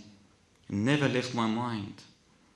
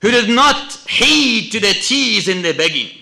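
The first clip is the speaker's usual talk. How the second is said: loud, raised sermon voice; forceful preaching; 11 semitones higher, 15 dB louder than usual. Real, not acted.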